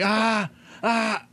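short, high mock screams; high "ah" cries, broken off abruptly; imitated screaming cut short. Retelling how the patient's screaming just stops, imitated cut-off screams. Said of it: "it just stops".

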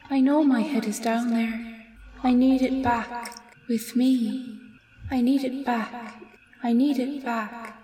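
high, hollow, mournful ghostly plea; forlorn ghost woman. Acted. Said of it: "forlornly just says".

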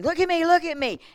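high, loud repeated order; commanding. Retold or imitated. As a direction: retell the pastor's insistent call. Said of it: "such a command in his voice".

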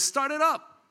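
high, lifted call to start; confident call. Acted. Old man to driver after fixing car, confident call.

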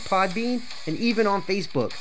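normal talking voice

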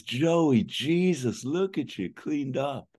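put-on high, loud surprised hello to an old friend; delighted greeting. Sizemore greets old partner Joey, delighted and high-pitched. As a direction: gush over how cleaned up he looks. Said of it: "Sizemore says".

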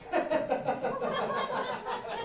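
a sudden high laugh bursting out; high burst of laughter. Real, not acted. Host laughing at his own line, a high burst of laughter.

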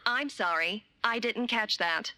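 automated recorded menu voice; robotic hotline recording, evenly paced; phone menu voice. Acted.

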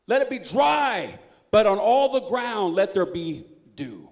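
loud, high, pleading reading of the fleece prayer; dramatic scripture reading. Acted. Reading Gideon's prayer aloud to the congregation, loud and pleading. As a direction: plead it out like Gideon begging God.

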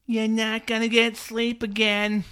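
high, drawn-out knowing warning; slow, singsong imitation of smug advice; mock warning parent.

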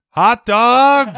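loud hot dog vendor call; stadium vendor hawking. Retold or imitated. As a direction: hawk it like a ballpark vendor.

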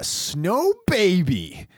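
slow, lifted sing-out; showy promo code. Pretend. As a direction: stretch it out like a cheesy ad. Reads mock promo code.

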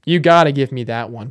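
normal talking voice

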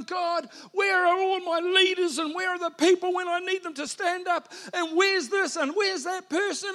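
high, loud plea to God; desperate cry to God. Retold or imitated. Overworked pastor yelling at God, frustrated and loud. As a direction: shout it up to heaven in exasperation.

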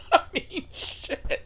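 real laughter breaking into high-pitched words; cracking up while speaking; laughing. Real, not acted. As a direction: talk through helpless laughing. Cracking up at his own story.

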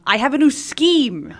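high, slow, scheming cartoon villain; throaty evil little schemer, drawn out; Plankton voice. Shown as a demonstration. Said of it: "Can you give me a Plankton voice?".

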